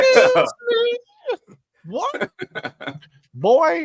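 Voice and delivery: very high, hammed-up offended squeal; host parodies Nala's sass in a shrill diva voice; sassy diva. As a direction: play the outraged diva, way up high. Mocking sassy Nala comeback.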